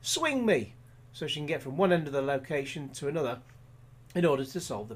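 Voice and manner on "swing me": high, urgent plea to be swung; mock action-heroine cry. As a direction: call out in a hurry, pitched up. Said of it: "and she tells him".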